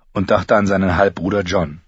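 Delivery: plain reading voice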